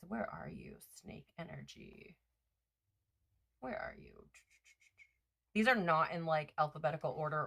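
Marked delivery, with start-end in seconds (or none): 0.1-4.2 hushed whisper, calling for the card; whispered search. Real, not acted. Reader whispering to herself, hunting for the snake card. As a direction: whisper to yourself while searching.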